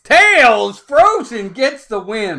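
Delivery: loud call of the result; loud announcement. Real, not acted. Streamer announces the coin toss result, loud call.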